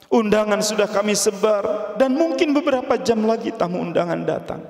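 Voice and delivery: high, urgent pleading; distressed protest. Acted. Father protests the cancelled wedding.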